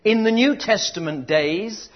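loud, slow, drawn-out preaching; booming, deliberate sermon delivery; full-force preaching. Real, not acted. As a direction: proclaim every word to the back row.

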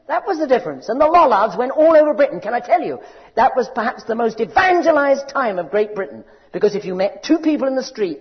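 loud, high, impassioned preaching; preaching at full force. Acted. Preacher proclaiming scripture to the congregation, loud impassioned preaching. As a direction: thunder it out like a revival preacher.

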